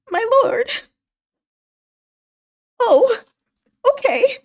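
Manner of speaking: high, wounded little voice; verge of tears. Acted. Hua Lu answering the lord, tearful high squeak. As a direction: squeeze it out in a mock-tearful falsetto, halting. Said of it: "on the verge of freaking tears".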